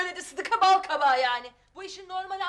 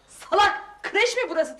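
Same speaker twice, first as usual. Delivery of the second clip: angry snap; 4 semitones higher, 5 dB louder than usual. Acted. Kızına öfkeyle çıkışma.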